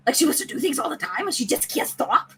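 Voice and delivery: quick, silly put-on pet voice; playful talking-dog voice; Blue the dog. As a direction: narrate for the dog in a funny accent. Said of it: "when I do blues accent".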